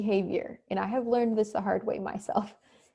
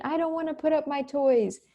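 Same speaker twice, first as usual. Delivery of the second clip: put-on whiny child; 6 semitones higher than usual. Child refusing to tidy toys.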